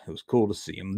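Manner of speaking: usual speaking voice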